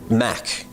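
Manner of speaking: MAC said with a crisp K at the end; hard final K. Real, not acted. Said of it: "With this K".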